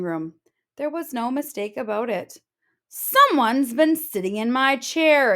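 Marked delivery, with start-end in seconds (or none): 2.9-5.3 louder exclaiming Papa Bear, a touch higher; father bear finding his chair used. Acted. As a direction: boom out the outrage. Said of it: "exclaimed Papa Bear".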